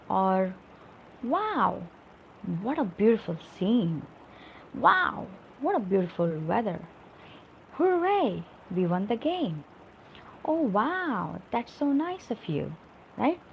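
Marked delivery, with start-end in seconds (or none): 1.2-12.7 demonstrated delight, interjections swooping high; exclamatory. Shown as a demonstration. Teacher showing feelings through exclamatory sentences, excited exclamations. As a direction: exclaim each example, leaping up on the interjection. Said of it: "this is called exclamatory sentence".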